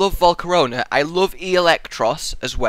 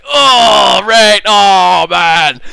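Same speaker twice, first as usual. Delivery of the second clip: loud, drawn-out mock wail; hammed-up cry of dismay; imagined viewers' groan; 5 semitones higher, 10 dB louder, much slower than usual. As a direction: wail it loudly as appalled Irish viewers. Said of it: "Irish people watching this going".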